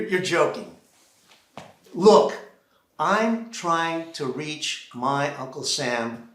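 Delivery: ordinary talk, nothing marked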